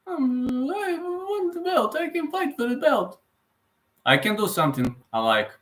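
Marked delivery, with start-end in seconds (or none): 0.1-3.2 very high squeaky mimicry; Leon Edwards impression. Streamer mimicking a champion who won the belt, high-pitched impression. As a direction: push the voice right up into a squeal. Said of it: "But Leon Edwards, mm, after winning the belt".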